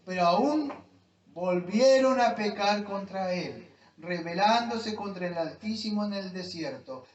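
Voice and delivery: loud, forceful reading that builds in weight; preaching scripture reading. Real, not acted. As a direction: proclaim the psalm, stretching key phrases as it intensifies. (lectura predicada con fuerza creciente)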